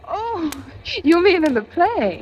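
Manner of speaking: a sudden high gasp, then a nervous question; startled. Acted. April, startled by his strangling remark, asks nervously. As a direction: catch your breath, then hope it's only the play.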